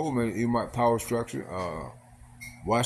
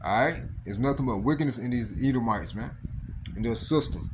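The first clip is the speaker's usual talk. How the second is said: ordinary delivery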